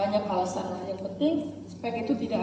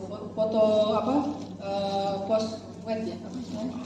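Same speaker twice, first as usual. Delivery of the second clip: ordinary talk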